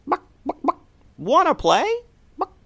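squeaky chicken asking to play. Acted. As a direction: cluck it like a chirpy hen. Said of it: "the chicken repeated".

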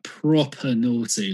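"proper naughty" slowly, British, no r in proper. Shown as a demonstration. Coaching an American on British slang, slow model pronunciation. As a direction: spell out "proper naughty" with "proper" ending in "ah". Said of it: "just say proper".

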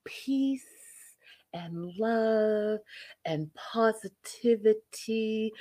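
slow, drawn-out zen chant; mock serene blessing. Host blessing her live viewers, mock-serene slow sign-off. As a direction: intone each phrase slowly, with long held words.

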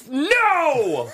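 high, loud, drawn-out no; hammed-up shout of dismay; retold outcry of refusal. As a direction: wail it in exaggerated protest. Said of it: "I literally went".

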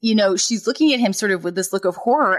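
normal talking voice

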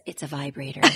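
very low, hushed mock correction; soft correction. Teresa corrects Jackie at dinner, soft and low. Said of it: "Teresa softly corrects her and says".

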